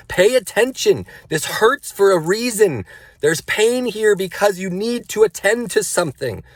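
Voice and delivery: high and loud, pressing inner command; urgent inner voice. Retold or imitated. His inner voice urging himself, high and loud. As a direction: let the inner voice demand attention.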